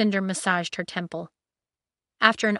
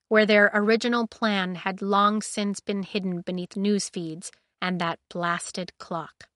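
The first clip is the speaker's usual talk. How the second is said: ordinary delivery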